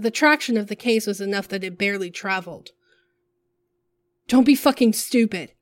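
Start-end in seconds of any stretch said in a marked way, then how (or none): 4.3-5.5 sharp warning, a little higher and louder; stern warning. A narrator's reading. Side Piece warns the person at the car, a stern warning. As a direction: warn them off firmly. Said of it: "Side Piece warned".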